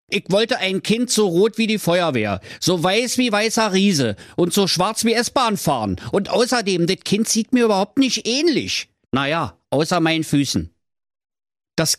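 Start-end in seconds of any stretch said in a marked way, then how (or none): 0.1-10.7 high, scolding Berlin husband voice; grumpy Berlin husband. Acted. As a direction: nag her from the armchair. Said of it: "sprach da der Werner". (hoch gepresster, schimpfender Berliner Ehemann)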